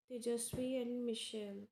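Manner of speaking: ordinary talk, nothing marked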